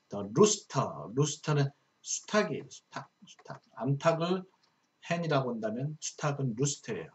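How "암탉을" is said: normal talking voice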